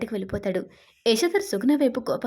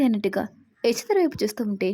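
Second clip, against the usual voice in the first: plain reading voice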